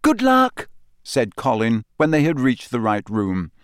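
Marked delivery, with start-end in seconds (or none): 0.0-0.7 put-on very high, loud boyish chirp; eager. A narrator's reading. Young fan seeing Harry off, eager. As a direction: call it out high and keen. Eager boy sees hero off.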